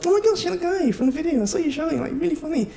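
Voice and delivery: put-on high, gushing girl recognizing him. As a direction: squeal it like a starstruck girl fan. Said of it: "When girls see you".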